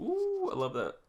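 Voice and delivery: a high, delighted squeal of joy; thrilled. Real, not acted. Vlogger squealing at the anime, delighted and excited. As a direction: gush over the play like a fan.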